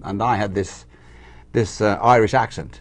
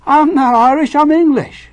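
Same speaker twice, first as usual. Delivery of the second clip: high, loud mimicked protest; indignant boy's retort; 8 dB louder, slower than usual. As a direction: shout back like an insulted kid. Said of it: "And I say".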